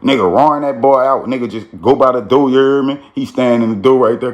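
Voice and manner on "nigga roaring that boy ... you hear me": ordinary talk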